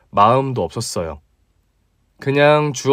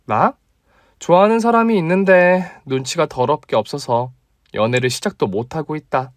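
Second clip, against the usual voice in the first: put-on higher, teasing young woman; female friend voice; 3 semitones higher than usual. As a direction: play the bold female doctor, pitched up. (높은 톤의 당찬 여자 목소리)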